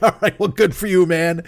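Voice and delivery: amused, laughing through it. Retold or imitated. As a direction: laugh while waving it off. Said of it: "I'm like".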